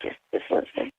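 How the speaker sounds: whispered, urgent and low; hushed plea. Real, not acted. Girlfriend whispering to her angry partner to listen. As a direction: beg quietly so no one overhears. Tense private fight, hushing.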